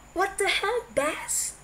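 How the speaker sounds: furious shout of disbelief; angry shout. A narrator's reading. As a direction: explode at him. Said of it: "he shouted angrily".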